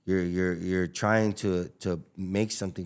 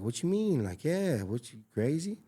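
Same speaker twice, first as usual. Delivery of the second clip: mock disbelief; 7 semitones higher than usual. Retold reply to wife about gifts.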